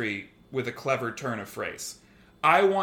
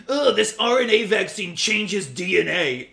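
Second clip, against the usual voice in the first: a touch higher, louder dumb-guy voice; mock anti-vaxxer; 5 semitones higher, 5 dB louder than usual. Imitating an anti-vaxxer commenter, mildly dumb voice. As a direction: lightly play a gullible conspiracy fan. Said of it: "If you're gonna say silly".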